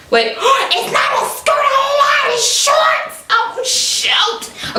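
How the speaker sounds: very high excited shout; real delighted surprise; surprised. Real, not acted. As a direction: gasp and squeal the correction. Realizing it's shorts.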